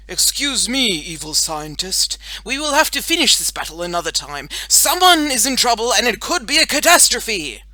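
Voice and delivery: loud proclamation building to high alarm; heroic announcement. A narrator's reading. Boy superhero leaves villain to rescue someone, urgently heroic. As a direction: declare the emergency like a superhero.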